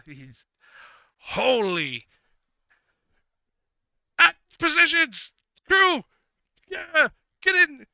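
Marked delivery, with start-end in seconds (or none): 1.2-7.9 high, loud panicked orders to the crew; a drunk captain yelling in sheer panic; panicked shouting. Acted. Drunk captain panicking as the dragon appears, shouting high and loud. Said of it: "you can tell he's totally panicked".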